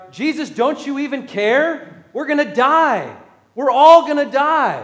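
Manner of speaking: acting out the frightened disciples shaking Jesus awake; panicked shout.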